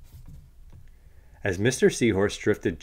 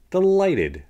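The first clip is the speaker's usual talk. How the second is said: high, louder chirpy answer; delighted reply; 11 semitones higher, 7 dB louder than usual. Acted. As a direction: pop the word up high with glee. Mister Stickleback answers the greeting.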